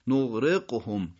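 نغرقهم slowly, gh first and q after; ghayn, then qaf. Shown as a demonstration. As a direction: keep gh and q distinct in نغرقهم. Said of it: "تلفظ بهتری". (نغرقهم شمرده، غین و قاف جدا)

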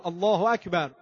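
ordinary delivery

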